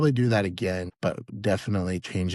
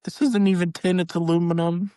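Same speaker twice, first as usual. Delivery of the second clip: high mock complaint; 10 semitones higher, 6 dB louder than usual. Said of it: "you're like".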